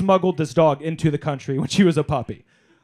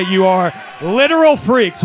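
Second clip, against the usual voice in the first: louder, slow, mock-disgusted; mock outrage; 3 semitones higher, 9 dB louder than usual. Real, not acted. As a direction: tease the fans with fake scorn. Mock insulting Phish fans.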